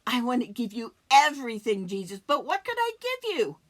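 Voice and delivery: loud, high, rushing devotion; fervent plea. Acted. Pleading with Jesus in the scene.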